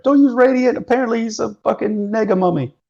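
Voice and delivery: high yelled warning; yelling. Acted. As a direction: shout it back across the room. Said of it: "but I yell back".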